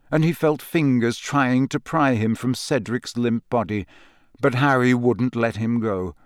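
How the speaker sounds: normal talking voice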